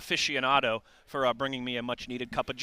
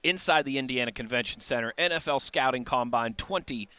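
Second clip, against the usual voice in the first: radio announcer; 2 semitones higher, 4 dB louder than usual. Acted. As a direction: open the show like a radio host. Radio show opening welcome.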